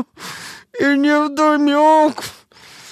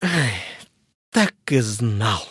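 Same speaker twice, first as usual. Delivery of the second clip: resigned groan; 8 dB quieter than usual. Acted. Patient groaning after crunch.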